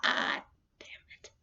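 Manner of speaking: whispered, caught-out grumble; defeated whisper. Retold or imitated. Dad caught with the fan on, whispering a defeated curse. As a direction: breathe it out like you just got busted. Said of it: "And he would be like".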